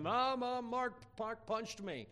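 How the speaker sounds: high-pitched tattling wail; mock-cry like a kid running to mom; imitated bawling. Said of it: "he starts bawling".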